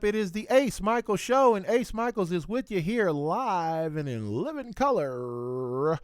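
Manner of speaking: big booming announcer intro; sportscaster voice. Shown as a demonstration. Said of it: "That's my sportscaster voice".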